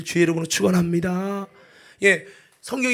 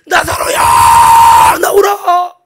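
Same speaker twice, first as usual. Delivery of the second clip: huge, long-drawn summoning call; commanding shout; 18 dB louder, much slower than usual. Acted. Jesus calls dead man out of tomb, commanding shout. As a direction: call out slowly at full power.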